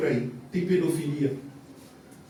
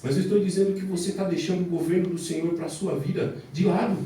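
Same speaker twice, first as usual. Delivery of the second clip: ordinary talk, nothing marked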